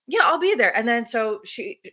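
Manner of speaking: ordinary delivery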